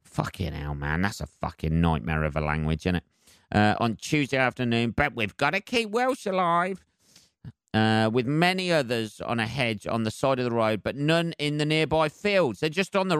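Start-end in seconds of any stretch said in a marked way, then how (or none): 4.9-6.8 mock patriot.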